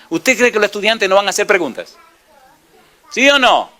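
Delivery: challenging the pews; rising preacher challenge. Real, not acted. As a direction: push the question at the congregation.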